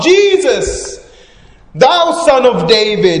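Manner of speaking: full high shout, name stretched out; soaring, insistent cry over the crowd. Acted. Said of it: "and then he shouts out".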